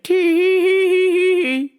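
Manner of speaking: high singsong tee-hee; sing-song giggle. Retold or imitated. Worker mimicking customer's sing-song giggle. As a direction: trill a silly giggle up high.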